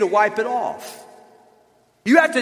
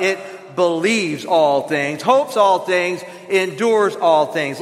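very slow, weighty reading; slow scripture emphasis; 3 semitones lower, slower than usual. Acted. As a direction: lean on each word of the verse. Congregation reciting with pastor.

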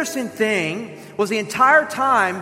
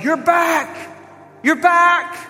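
joyful exclamation; 8 semitones higher, slower than usual. Retold or imitated. Wife shouting with joy as her husband returns home. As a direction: shout it as if he'd come home. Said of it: "his wife exclaimed".

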